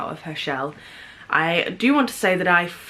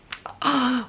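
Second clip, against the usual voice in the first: mock gasp; 6 semitones higher than usual. Book twist, he came back.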